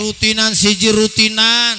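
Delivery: loud, high call-and-response lead; rallying preacher. Real, not acted. Preacher rallying Mangunjaya villagers to pray together, high loud rallying call. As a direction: belt the slogan out for the crowd to answer.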